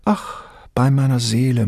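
ordinary delivery

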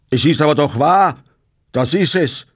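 put-on old man shouting back in anger; angry shout. A narrator's reading. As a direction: yell it out red-faced with rage. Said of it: "schrie Bartle mit zornrotem Gesicht".

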